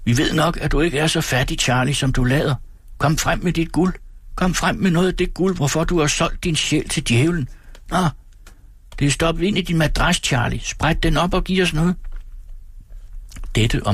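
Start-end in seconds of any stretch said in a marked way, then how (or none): 0.0-8.1 put-on high, jeering boys' calls at ordinary volume; singled-out mockery in a higher young voice; taunting street boys. A narrator's reading. Street boys taunt the old shopkeeper, calling out. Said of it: "idet de råbte til ham". 9.0-11.9 put-on high, pestering boys' voice; taunting street boys. A narrator's reading. Boys tell the old man to slit his mattress, jeering. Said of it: "idet de råbte til ham".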